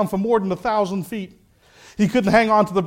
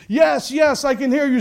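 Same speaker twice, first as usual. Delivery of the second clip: high, acted shout back to a rescuer; calling up eagerly; 8 semitones higher, 5 dB louder than usual. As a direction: answer loudly from far below. Stranded Jack calls up to voice.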